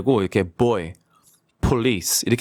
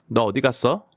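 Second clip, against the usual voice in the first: ordinary talk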